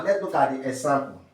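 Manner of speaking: ordinary talk, nothing marked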